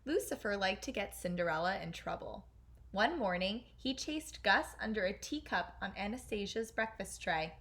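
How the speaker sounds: plain reading voice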